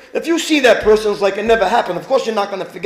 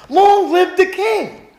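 honoring the king; 8 semitones higher, slower than usual. Acted. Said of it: "Look how he answered".

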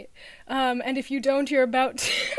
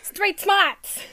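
loud, high catchphrase impression; John Mulaney; 7 semitones higher, 7 dB louder than usual. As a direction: shout it like a stand-up comedian. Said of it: "more the persona".

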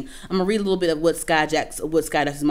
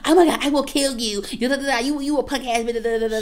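higher, put-on tough girl voice, mocking; 7 semitones higher than usual. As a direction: parody her Instagram Live rant, a hint of a fake hard act.

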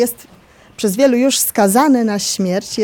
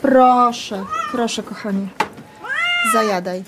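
gently calm a hungry cat while feeding; soothing a pet. Real, not acted. (łagodne uspokajanie kota)

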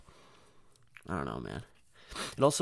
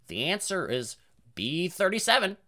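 high parrot announcing; 7 semitones higher than usual. Acted. Parrot giving away test answers, high and parroty. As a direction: imitate a parrot blurting out answers. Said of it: "'Cause the parrot would, it would go".

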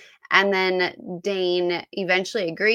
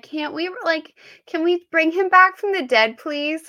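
high, louder begging; mock pleading; 10 semitones higher, 5 dB louder than usual. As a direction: plead with the author to undo it. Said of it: "I just was like".

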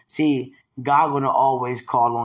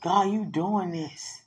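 ordinary delivery

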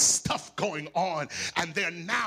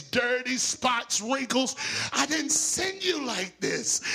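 a hint of a mother scolding, higher; 6 semitones higher, 4 dB louder than usual. Mother scolding her child who came home messy, mild mock scold. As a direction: fuss at the child like mom.

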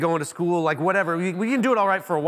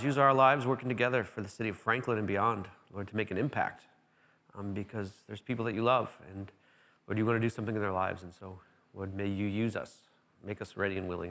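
soft, low, unhurried prayer voice; quiet prayer; 8 semitones lower, 4 dB quieter than usual. Acted. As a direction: pray softly over the congregation. Pastor prays aloud before sermon.

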